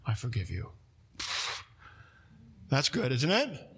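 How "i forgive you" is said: low, soft "I forgive you"; God forgiving, tender and quiet; God speaks to the repentant, softly and tenderly; tender forgiveness. Acted. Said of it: "what God said".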